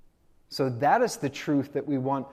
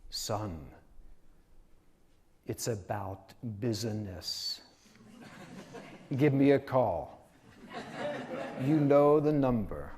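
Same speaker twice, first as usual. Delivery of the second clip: put-on gruff Italian accent. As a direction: imitate a gruff Italian father, stretching "business" into three syllables. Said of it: "in his kind of gruff Italian accent".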